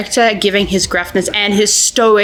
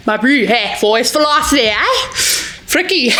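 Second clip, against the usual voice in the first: hammed-up South African Afrikaans accent, pitched up toward the end; thick Afrikaans accent; 3 semitones higher than usual. Contestant parodying the Beekeeper villain, mock thick Afrikaans accent. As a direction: put on a thick Afrikaans-accented would-be Four Ways voice. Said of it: "have a thick South African Afrikaans accent".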